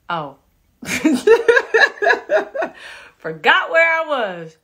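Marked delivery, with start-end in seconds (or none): 0.8-3.1 high, loud burst of laughter; laughing. Real, not acted. Host laughing at a viewer's answer. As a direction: crack up.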